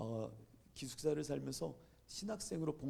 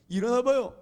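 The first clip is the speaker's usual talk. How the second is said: loud, higher, insistent call; calling to wake; 7 semitones higher, 12 dB louder than usual. Retold or imitated. Student waking a homeless stranger, loudly insistent. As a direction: rouse a sleeper, acted out.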